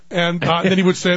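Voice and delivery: usual speaking voice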